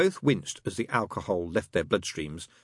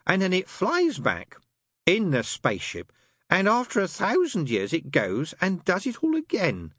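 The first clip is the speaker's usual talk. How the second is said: quick; 12 semitones higher, 3 dB louder than usual. Acted. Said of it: "said Crowley quickly".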